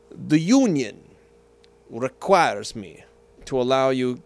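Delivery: slow, low Russian conductor scolding the orchestra; mock Russian accent, deliberate and cold; imitated Russian accent, measured and contemptuous. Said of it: "in his beautiful Russian accent".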